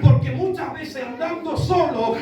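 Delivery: forceful sermon delivery, building to a shout; preaching. Acted. Preacher warns congregation about the voice of man, forceful preaching. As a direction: preach it out, stretching the key phrases.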